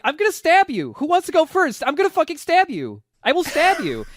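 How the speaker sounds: high, loud mock threats of a scrappy kid; threatening girl. Kid on the boat threatening the five travelers, high loud mock threats. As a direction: play the knife-waving kid shouting threats. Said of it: "She calls them punks and just keeps going like".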